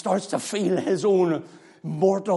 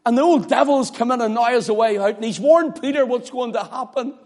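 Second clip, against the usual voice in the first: ordinary talk, nothing marked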